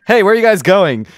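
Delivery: loud, high head popping down to ask; head calling out. Acted. Taxi's head to passengers as it takes off, loud call. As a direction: shout it down at the passengers. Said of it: "a head drips down and says".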